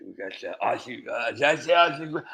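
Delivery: ordinary delivery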